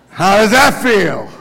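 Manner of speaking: laughing question. Acted. Mock baptism joke, laugh.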